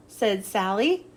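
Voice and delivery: ordinary delivery, nothing marked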